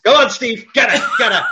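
mock cat shout. Cat voiced urging alien to attack.

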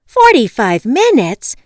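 loud, high, stretched outburst; outraged disbelief. A narrator's reading. Caller hears how long the locksmith will take, outraged. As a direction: throw it back in loud, slow disbelief.

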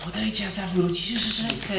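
high, distant otherworldly voice; a bargain whispered into the mind; eerie voice in head. Acted. Creature voice in head.

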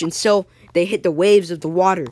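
ordinary delivery

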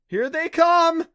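high, loud, stretched-out warning; alarmed cry. A narrator's reading. Clancy warning as jellyfish stream toward them, crying out. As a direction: cry out slowly at full voice. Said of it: "Clancy cried".

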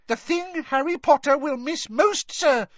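very high, urgent elf voice; squeaky house-elf urgency; Dobby squeaking. A narrator's reading. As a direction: squeak it out in a panic to hurry him. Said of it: "squeaked Dobby".

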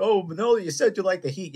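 high, loud teasing hosts; mock host voice. Teasing the guest about liking heat, in a high mock voice. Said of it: "I'll go".

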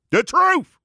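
high, drawn-out proclaiming cry; preacher's proclamation. Acted. The Rev brings his listeners the truth, high proclamation.

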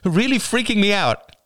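high, panicky outburst; freaked-out cry. A narrator's reading. Rachel tells Oliver his panicking scares her, crying out. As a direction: pour it out high-pitched and rattled. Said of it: "cried Rachel".

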